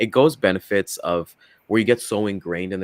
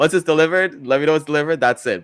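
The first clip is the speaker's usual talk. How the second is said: raised emphatic customer quote; 7 semitones higher, 8 dB louder than usual. Retold or imitated. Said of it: "Tony Darnell said it".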